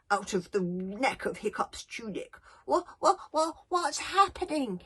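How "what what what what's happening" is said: sleepy little dragon. Acted. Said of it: "he asked sleepily in Dragonese".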